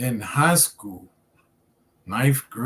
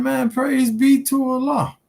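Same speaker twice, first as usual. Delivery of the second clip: retold joyful praise; 13 semitones higher than usual. Joy at cigarettes thrown away.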